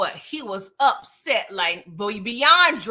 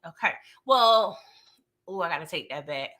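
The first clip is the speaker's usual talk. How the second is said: ordinary delivery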